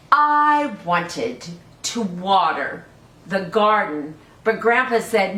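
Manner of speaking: put-on lively read-aloud for small children; storybook reading. Teacher reading a picture book to children, lively.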